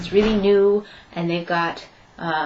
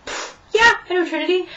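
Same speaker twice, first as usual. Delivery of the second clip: mock smug; 10 semitones higher than usual. Retelling her reply to the interviewer, playfully smug. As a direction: toss it off like it's obvious. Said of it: "And so I was like".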